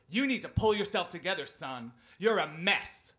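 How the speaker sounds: loud imitated teacher's dressing-down; loud, harsh reprimand; angry scolding. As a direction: berate a pupil with forceful emphasis. Teacher scolds the crying pupil.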